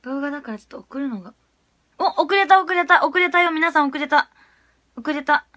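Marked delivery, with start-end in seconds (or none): 2.0-5.5 higher, louder flustered cries; flustered excitement. Real, not acted. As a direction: blurt it out in a panic. Panicking over delayed upload.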